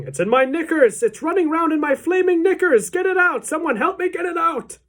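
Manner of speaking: high, loud, climbing to a shriek; panicked screaming. A narrator's reading. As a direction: scream for someone to rescue you. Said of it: "the man was screaming".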